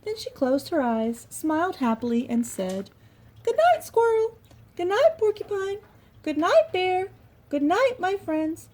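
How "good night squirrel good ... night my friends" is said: very high, softening goodnights; gentle tiny mouse bedtime; high tender mouse; sleepy mouse voice. Acted. Mouse says goodnight in bed.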